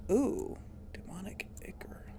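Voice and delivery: whispered delight over loot; hushed, pleased whisper; excited whisper. Real, not acted. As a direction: breathe it like a treasure. Finding loot.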